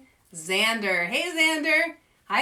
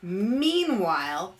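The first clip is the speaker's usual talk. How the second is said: ordinary delivery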